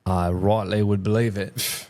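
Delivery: slow put-on English accent; a slow, hammy stab at an English accent. Co-host hamming a slow English accent for the podcast. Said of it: "Not sure where this accent is coming from".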